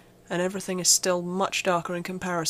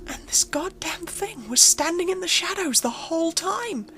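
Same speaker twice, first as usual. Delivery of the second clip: much higher and louder, frantic, building to near-shouting; panicked; 7 semitones higher, 3 dB louder than usual. Acted. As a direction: build from shaky disbelief to full panic. Realises it was there all along.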